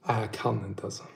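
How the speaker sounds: ordinary talk, nothing marked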